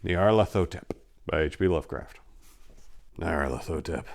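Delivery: low, hushed, slow announcement of the title; ominous title reading. A narrator's reading. Host opening his Lovecraft reading in an ominous hush. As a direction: intone the title like a dark invocation.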